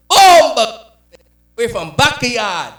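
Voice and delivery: loud, high burst of Jamaican pride; proud Jamaican. Acted. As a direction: boast in a booming Jamaican accent, drawn out. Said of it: "Jamaican people were proud people".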